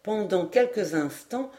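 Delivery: ordinary delivery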